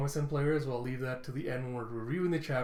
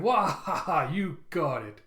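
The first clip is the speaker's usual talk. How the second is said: big loud laugh into agreement; belly-laugh, then slowly agree; acted boisterous "wah ha ha"; Jinbei's hearty laugh; 3 semitones higher, 5 dB louder, slower than usual. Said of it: "You have Jinbei going".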